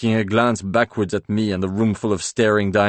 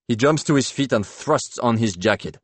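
usual speaking voice

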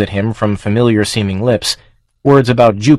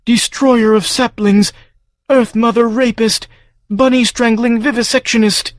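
accusing farm creature; 13 semitones higher than usual. Acted. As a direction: condemn him like a wounded beast.

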